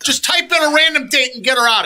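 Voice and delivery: high, loud impatient order; fed up, just get it done; exasperated. Retold or imitated. Exasperated rant continued.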